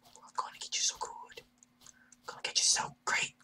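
unvoiced sneaky whisper. Real, not acted. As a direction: whisper it like you're stalking prey. Sneaking up on enemy.

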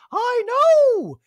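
very high, loud, drawn-out surprise; Dorit impression. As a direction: act shocked in a squeaky put-on voice. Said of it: "And Dorit's like".

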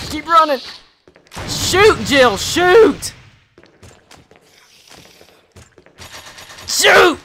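high, loud frantic yells; frantic shouting. Real, not acted. Player yelling orders at Jill, frantic and loud. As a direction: yell commands at her. Urging character in fight.

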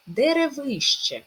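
"Деревище" slow and high on stressed ви; "Деревище", lifted on the stressed third syllable. Shown as a demonstration. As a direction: stretch ви in "Деревище". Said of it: "Наголос на и".

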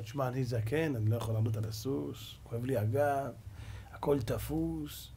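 low, soft, aching complaint; feeble old man. Acted. Mordechai pleading age to avoid the horse, low feeble old man. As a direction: sag into a weary old man's excuse.

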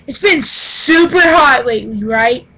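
usual speaking voice

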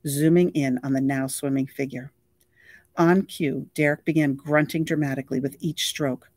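plain reading voice

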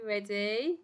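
plain reading voice

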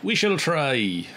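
wobbly put-on Scottish; attempted Scottish accent. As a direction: struggle through a bad Scottish accent. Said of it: "It's not working".

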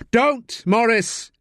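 sharp high warning shout. Acted. Detective shouts to stop Morris reaching his uncle, loud warning. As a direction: stop him in his tracks. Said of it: "Staff shouts".

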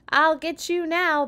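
high, loud, drawn-out holler. A narrator's reading. President chasing his children in play, hollering. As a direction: holler it as a playful threat. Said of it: "the president hollered".

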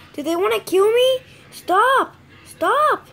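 high, loud panicked yells at the zombies; panicked yelling. Real, not acted. Gamer yells at spawning zombies, panicked high shout. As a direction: scream at them to stop.

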